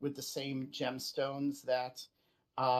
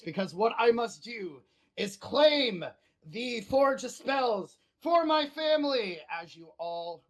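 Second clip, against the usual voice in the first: voicing a player's character; 6 semitones higher, 5 dB louder than usual. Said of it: "sorry, I took over your voice".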